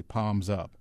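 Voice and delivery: ordinary delivery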